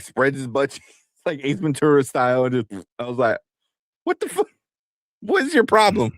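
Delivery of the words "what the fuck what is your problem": high-pitched, incredulous demand; hammed-up shocked indignation; retold outrage. Dad to son after the fart prank, appalled.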